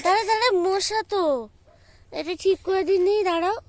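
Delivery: loud, high shout of wait; urgent shout. Real, not acted. Mother shouts at the child to wait while she deals with a mosquito. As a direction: shout at them to hold still.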